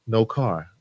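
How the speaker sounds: usual speaking voice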